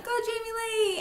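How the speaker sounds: high cheering call from the crowd; crowd cheering yell. Retold or imitated. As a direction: cheer her name like a spectator. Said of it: "they're yelling".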